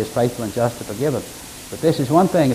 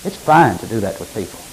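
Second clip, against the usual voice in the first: ordinary delivery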